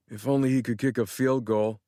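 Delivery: ordinary delivery, nothing marked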